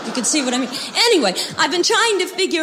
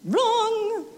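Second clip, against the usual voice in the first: sharp high exclaim; emphatic wrong; 10 semitones higher than usual. Acted. Correcting people who mispronounce her name, sharp high wrong. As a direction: snap out a correction.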